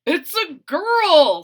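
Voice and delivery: slow, loud, high announcement; announcing. A narrator's reading. Dad announces the new baby, slow and proud. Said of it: "said Froggy's dad".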